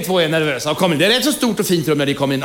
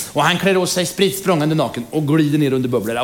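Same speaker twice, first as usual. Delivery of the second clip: ordinary delivery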